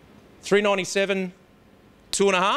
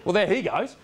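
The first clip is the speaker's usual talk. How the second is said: ordinary talk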